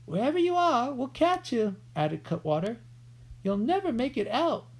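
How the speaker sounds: high taunting outlaw voice. A narrator's reading. Cutwater threatening the boys, high taunt. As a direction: taunt the dark.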